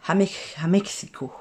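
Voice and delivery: unvoiced whisper aside. Real, not acted. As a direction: whisper it under your breath.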